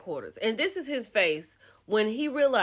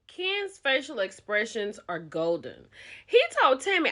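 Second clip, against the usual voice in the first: ordinary talk, nothing marked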